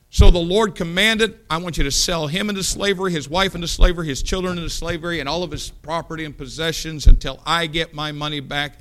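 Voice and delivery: loud, high-pitched preaching of the parable; preaching at full force. Acted. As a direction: drive the story home to the congregation.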